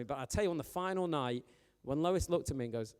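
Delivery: ordinary delivery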